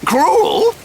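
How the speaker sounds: high, rising theatrical disbelief; incredulous echo. Acted. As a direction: fling the word back, scandalised. Villain echoes accusation in disbelief.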